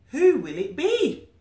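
high, slow wondering voice; suspenseful question. Acted. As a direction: tease the listener with who it could be. Storybook suspense question to listeners.

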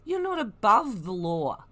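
usual speaking voice

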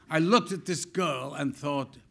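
normal talking voice

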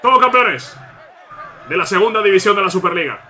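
high, loud trophy cry; celebratory shout. Real, not acted. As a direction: shout it to the sky. (grito de celebración)

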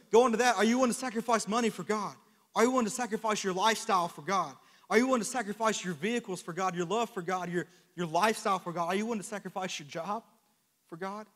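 loud, high preaching call; full-force preaching. Acted. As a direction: call out to the congregation.

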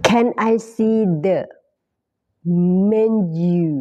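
Can I see the menu said slowly, menu as "men-yoo"; careful slow English. Shown as a demonstration. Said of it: "อันนี้ออกเสียงเป็น menu นะคะ". (อ่าน menu ว่า เมน-ยู ช้าๆ)

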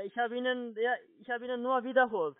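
ordinary talk, nothing marked

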